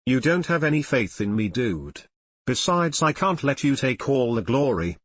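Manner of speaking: ordinary delivery, nothing marked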